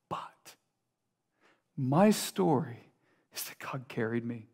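lightly voiced older believer, soft and low; hushed, humble testimony, slowing on "my story". Older saint recalling God's help, lightly voiced and subdued.

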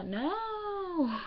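high, drawn-out accented "No"; "No" sung out high in a hammed-up accent; exaggerated accent. Shown as a demonstration. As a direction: stretch "No" high to ham up the accent. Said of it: "Like, with an accent".

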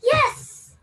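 high loud shout of yes; excited yes. Retold or imitated. Girl answering dad about wushu, loud excited yes. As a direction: burst out eager.

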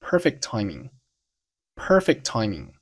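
slow "perfect timing" with one t only; unreleased final t. Shown as a demonstration. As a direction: hold the t of "perfect" straight into "timing". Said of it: "放在一起就读成了".

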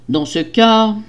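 usual speaking voice

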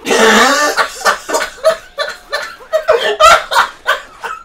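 breathless laughing fit; long high giggle. Real, not acted. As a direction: lose it completely.

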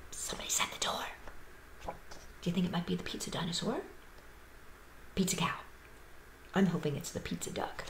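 hushed, excited whisper. Pretend. Host whispers to the children about the visitor. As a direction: whisper it so the guest won't hear.

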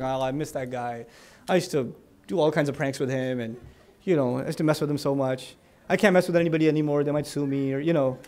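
low, soft, wistful sigh of a line; a low hushed ache of missing a friend; fond longing. Retold or imitated. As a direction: sigh it like you truly miss them. Missing friend in New York.

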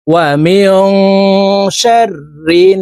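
slow chant, humming the n of শাররিন through the nose; nasal ghunnah. Shown as a demonstration. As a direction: hold the end of শাররিন as a long nasal tone. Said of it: "নাকের বাসি থেকে গুন্নাহ করে পড়ব".

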